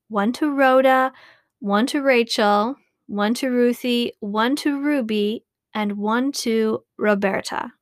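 ordinary delivery

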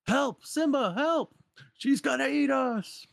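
very high, panicked cries for help; panicked meerkat. Acted. Meerkat screaming to Simba for help.

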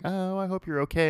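high-pitched, sincere sympathy; imitating sincere concern. Customer's wife checking on fallen worker, sincerely concerned. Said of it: "like, really sincere".

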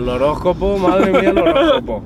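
rising into a high, loud exclamation; surprised. Real, not acted. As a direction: play up amazed disbelief. Reacting to the horoscope remark.

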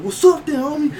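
loud, high-pitched dare; confrontational challenge. Retold or imitated. Bigger cashier stepping up to a shorter man, loud challenge. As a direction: square up to him, voice jumping up. Retold face-off, squaring up.

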